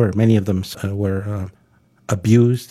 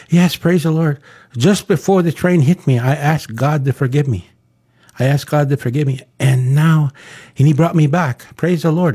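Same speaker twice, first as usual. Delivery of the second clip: higher-pitched acted voice of praise; retold exultant testimony, pitched up; jubilant testimony; 6 semitones higher, 3 dB louder than usual. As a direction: act out the revived boy testifying. Revived boy testifies to his parents.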